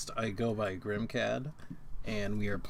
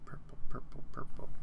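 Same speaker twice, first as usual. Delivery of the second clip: whispering. Real, not acted. Searching for dice, muttering.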